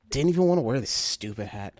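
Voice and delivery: low, soft, sulky grumble; deflated holiday grouch; Grinch-like grumpiness. Real, not acted. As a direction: grumble it low under your breath. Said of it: "I feel like a Grinch today".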